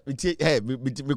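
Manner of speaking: ordinary talk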